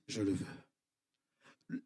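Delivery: very slow, soft, low "je le veux"; the Lord's hushed reply. Acted. Pastor voicing the Lord's quiet yes, very slow and soft. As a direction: let the Lord answer in a slow, low hush.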